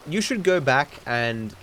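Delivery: ordinary delivery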